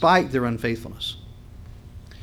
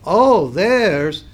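usual speaking voice

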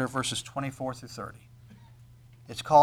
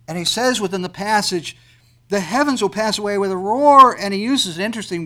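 loud, soaring pulpit delivery with stretched climaxes; full-force preaching; 6 semitones higher, 13 dB louder than usual. Acted. Preacher to congregation about the last day, soaring loud preaching. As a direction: thunder it out to the whole room.

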